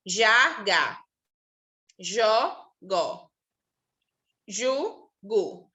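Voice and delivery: já, jó, ju with zh against gá, gó, gu with hard g; contrasting J and G syllables. Shown as a demonstration. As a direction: sound out each pair with clear contrast. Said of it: "os sons não ficam os mesmos".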